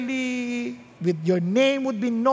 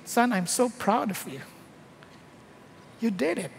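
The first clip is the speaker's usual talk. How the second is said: softer, proud and gentle; tender father; 4 semitones lower, 5 dB quieter than usual. Acted. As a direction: speak softly like a proud father to his son. God praising the persecuted believer.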